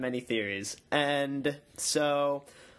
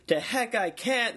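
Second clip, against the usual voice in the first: defiant retort; 9 semitones higher than usual. Retold or imitated. Catelyn defies the guards.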